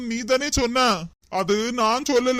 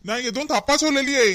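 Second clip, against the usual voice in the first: ordinary delivery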